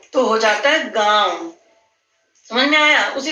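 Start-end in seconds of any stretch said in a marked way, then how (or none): 0.9-1.5 गांव with a long, nasal आ; nasalised vowel. Shown as a demonstration. Teacher sums up for children how गांव sounds, nasalised vowel. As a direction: draw out गांव, letting the आ ring through the nose. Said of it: "नाक से आवाज आ रही है ना थोड़ी".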